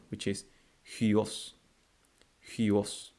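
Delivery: huos said slowly, hu gliding into os; slow word demo. Shown as a demonstration. Teacher modelling the Greek word for sun. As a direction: draw out huos for learners. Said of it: "the word for sun".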